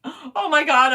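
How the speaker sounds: loud, high shocked oh my God; mock horror; shocked gasp. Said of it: "I said to myself".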